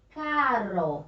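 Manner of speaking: "caro" stretched with a light tongue-tip tap; weak R. Shown as a demonstration. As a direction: sound out "caro" slowly, tapping the R. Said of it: "com a pronúncia fraca".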